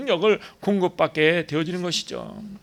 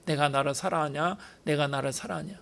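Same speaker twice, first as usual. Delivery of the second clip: softer, gently asking the same question; Jesus gently asking Peter, softer; gentle Jesus not scolding; 8 dB quieter than usual. Retold or imitated. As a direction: coax Peter tenderly, no reproach. 쫓아가셔서 베드로 마음을 어루만져.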